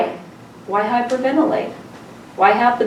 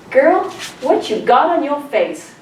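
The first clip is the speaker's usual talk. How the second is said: higher, sassy challenge from a nurse; put-on big-nurse voice, pitched up; big hefty nurse; 5 semitones higher than usual. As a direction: imitate a nurse demanding an explanation. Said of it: "this big, hefty nurse".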